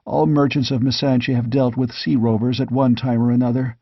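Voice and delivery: usual speaking voice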